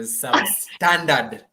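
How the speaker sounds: "substandard" drawn out slowly; stress on SUBSTANDARD. Real, not acted. Host belittling Arsenal's past opponents, "substandard" slow and emphasised. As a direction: stretch "substandard" to underline it. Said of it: "Underline the word substandard".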